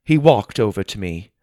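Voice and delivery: ordinary delivery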